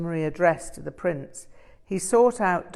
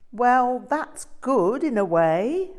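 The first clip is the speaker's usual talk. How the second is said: slow, higher, self-satisfied monk official; pompous proclamation; 7 semitones higher, 4 dB louder, slower than usual. A narrator's reading. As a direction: announce it like a smug idiot in charge. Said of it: "one of the chief idiots proclaimed".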